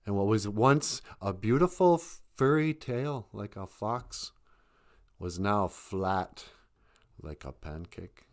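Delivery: ordinary delivery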